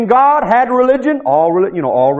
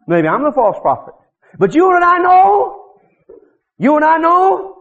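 normal talking voice

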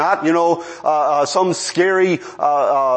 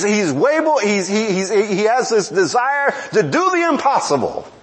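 normal talking voice

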